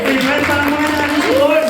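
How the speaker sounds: loud, ringing call to the church; preaching at full force. Acted. Pastor rousing the congregation, loud preaching. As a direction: lift the room with it.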